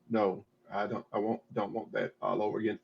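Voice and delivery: ordinary talk, nothing marked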